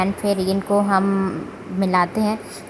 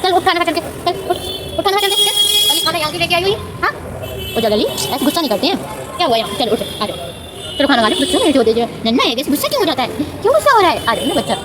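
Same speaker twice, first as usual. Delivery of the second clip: coaxing pet talk; 6 semitones higher than usual. Real, not acted. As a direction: coax the sulking pet to come and eat.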